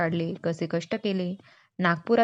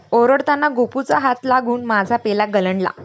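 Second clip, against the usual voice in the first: usual speaking voice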